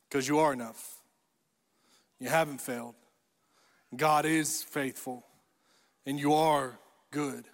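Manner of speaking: calm, sincere and low; gentle reassurance. Real, not acted. Reassuring answer to the negative voices.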